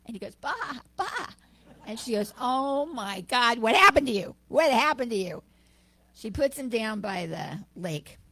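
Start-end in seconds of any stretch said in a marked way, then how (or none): 0.4-1.3 high little baa-ing bleat; sheep bleat. Acted. Cub answering the lioness with a high sheep bleat. 2.4-5.4 loud and higher, dismayed cry; horrified lioness. Acted. Lioness dismayed that the cub bleats, loud and high.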